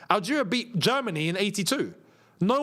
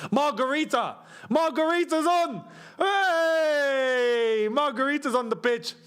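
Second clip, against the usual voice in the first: excited cheering; 7 semitones higher, slower than usual. Real, not acted. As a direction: hail the sub like a hero. Cheering a player coming on.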